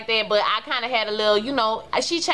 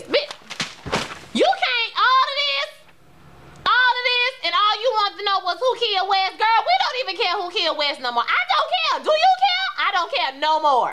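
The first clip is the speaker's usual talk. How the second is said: exasperated outburst; 10 semitones higher than usual. Real, not acted. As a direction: shout out your disbelief at the show. Exasperated rant about the show.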